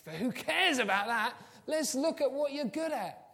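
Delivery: high, loud, rousing encouragement; enthusiastic dismissal. Acted. Teacher urging focus on what's good, exuberant. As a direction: brush it aside exuberantly. Marker cheering a pupil on.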